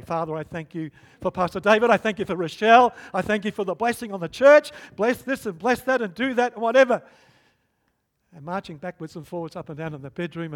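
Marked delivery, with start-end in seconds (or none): 0.0-7.0 fast, loud, rattled-off prayer list; rushed Pentecostal prayer. Retold or imitated. Praying aloud alone in the bedroom, loud and hurried. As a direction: gabble through the prayer like a habit. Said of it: "in the usual Pentecostal way".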